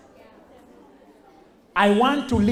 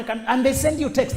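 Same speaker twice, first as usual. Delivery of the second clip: ordinary talk, nothing marked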